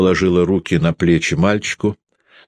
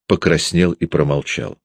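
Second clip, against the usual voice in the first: usual speaking voice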